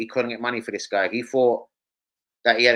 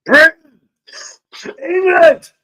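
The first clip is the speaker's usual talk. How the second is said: high, loud mock rally cry; hammed-up patriotic yell; shouting out; 9 dB louder, slower than usual. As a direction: bellow it out to the whole country. Calling out to absent viewers.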